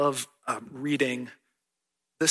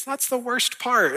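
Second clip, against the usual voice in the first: put-on much higher, louder whiny kid complaint; imitating his son; 11 semitones higher, 5 dB louder than usual. Pastor voicing his son's disappointed complaint, high and louder. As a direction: groan it like a let-down seven-year-old. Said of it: "And he goes".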